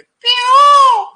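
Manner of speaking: very high, loud, drawn-out peacock call; a long, shrill-pitched bird cry imitation. Shown as a demonstration. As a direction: scream it like a peacock. Teacher names the peacock dance.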